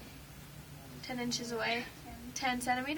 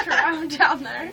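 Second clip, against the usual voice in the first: laughing; 3 semitones higher, 12 dB louder than usual. Real, not acted. Awkward about reaching under shirt.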